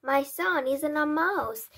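loud, high, slow protest; indignant shout. A narrator's reading. Father denies his son is a mouse, shouting slowly. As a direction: shout it out, stretching the words in outrage. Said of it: "shouted Mister Jenkins".

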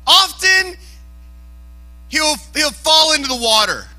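high, loud insistence; emphatic preaching. Real, not acted. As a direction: raise the voice to hammer the point home. Drives home the word often.

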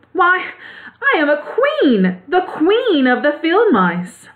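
high, indignant mouse queen voice; indignant little queen. A narrator's reading. Mouse queen corrects the Woodman about her rank, indignant. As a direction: announce your rank in high-pitched indignation. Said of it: "cried the little animal indignantly".

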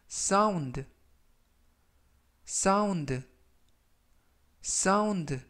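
teacher models sound carefully; model pronunciation. Shown as a demonstration. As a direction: sound with a clear final d. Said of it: "라고 발음하시면 되겠고요".

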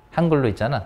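usual speaking voice

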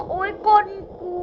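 pained yelp. Real, not acted. A player yells at the teammate who shot him, pained yelp. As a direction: yelp like a bullet just hit you.

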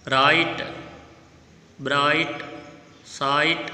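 "राइट, ब्राइट, साइट" with the ai stretched; ai sound. Shown as a demonstration. Teacher has pupils notice ai, stretched in "राइट, ब्राइट, साइट". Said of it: "आई के sound को ध्यान देना है".

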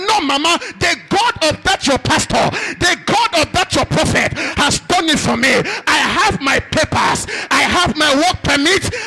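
loud, high ecstatic shout; son shouting for joy. Retold or imitated. Son on the phone to his mother, shouting ecstatically. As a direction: yell the good news down the phone. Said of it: "was screaming and shouting on the phone".

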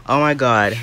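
ordinary talk

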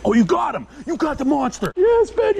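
ordinary talk

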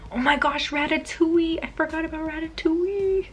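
high, thrilled gush over a remembered favorite; squealing with excitement; excited squeal. Real, not acted. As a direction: burst out with delight. Suddenly remembers favorite film.

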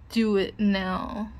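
slow, loud order; stern command. Acted. Ordering the pup to bark, slow and stern. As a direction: spell out the order slowly and firmly.

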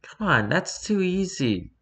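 high, lightly voiced girl cat yelling; a hint of an eager shout; mild yell. A narrator's reading. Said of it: "yelled Star Paul".

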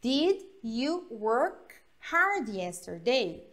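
slow, deliberate classroom question; slow model question. Shown as a demonstration. Teacher models the past question for students, slow. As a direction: say each word slowly for learners. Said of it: "Então".

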